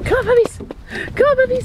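high-pitched coaxing call to the puppies; high puppy call. Real, not acted.

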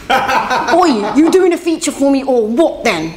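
loud, high mock London accent. Artist repeating her impression of a feature request, London accent. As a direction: exaggerate a pushy London demand, half laughing. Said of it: "That's my London accent".

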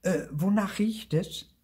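high-pitched eager question; curious Lucy. A narrator's reading. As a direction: ask with piping curiosity. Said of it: "Lucy kam neugierig näher".

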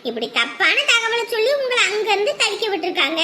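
ordinary talk

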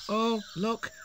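sudden high "oh, look"; startled notice, voice jumping up; surprised. A narrator's reading. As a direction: catch yourself, suddenly surprised. Narrator suddenly notices the time.

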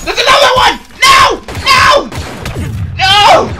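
high terrified yelling; panicked screaming. Real, not acted. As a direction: scream no at the enemy. Another enemy, screaming.

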